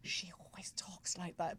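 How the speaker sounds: whispered reply. Retold or imitated. The colleague whispering back to her. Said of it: "And he goes".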